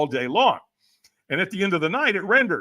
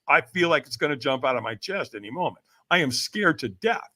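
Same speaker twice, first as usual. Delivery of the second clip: ordinary delivery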